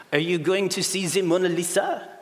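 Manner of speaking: put-on broken English in a French accent; thick French accent. Louvre lady questions two tourists in the lift, French accent. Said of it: "thick French accent".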